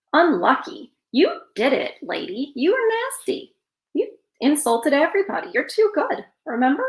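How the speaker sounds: high, mocking jabs at the princess; mocking scold. Real, not acted. Teller scolding the whining princess, high and mocking.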